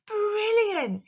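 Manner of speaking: brilliant high and loud, thrilled; excited. Shown as a demonstration. Teacher showing pupils the excited way, thrilled. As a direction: squeal brilliant with real surprise. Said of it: "You can hear the surprise and the excitement".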